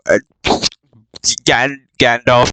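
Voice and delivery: ordinary talk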